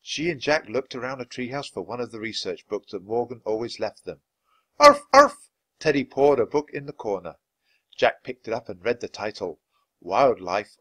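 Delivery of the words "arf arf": high yappy arf, arf; little dog bark. Acted. Teddy the dog pointing out a book, yappy bark. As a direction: bark like a small eager puppy.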